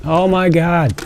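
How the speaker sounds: stunned gasp of wonder; genuinely taken aback; amazed. Real, not acted. Unboxing a huge truck.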